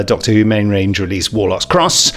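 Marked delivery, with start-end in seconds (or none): none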